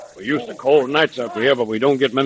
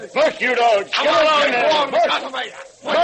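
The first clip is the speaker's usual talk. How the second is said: urging the dogs; 14 semitones higher, much slower than usual. Acted. Driving the dogs hard.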